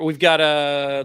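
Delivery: slow, stretched through a yawn; words dragged by a yawn. Real, not acted. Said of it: "God, I'm yawning like a motherfucker".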